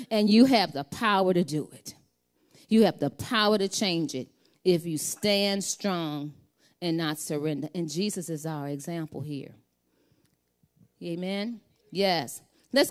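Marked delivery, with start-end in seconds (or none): 0.1-7.7 full-force preaching. Acted. Preacher urging the congregation not to surrender, preaching hard. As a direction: drive the point home from the pulpit.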